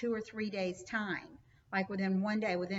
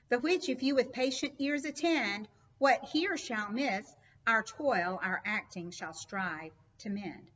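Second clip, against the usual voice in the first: louder, raised declamation of the closing lines; dramatic poetry reading; 3 semitones higher, 4 dB louder than usual. Acted. Teacher reading the prologue's ending to the class, declaiming. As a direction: give the couplet a stage-actor's lift.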